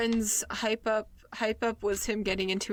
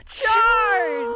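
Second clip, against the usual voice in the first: high, loud, drawn-out yell; yelled battle cry; 14 semitones higher, 10 dB louder than usual. Acted. Player ends the countdown and signals the party, yelling. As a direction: bellow the order to attack. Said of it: "Yell charge!".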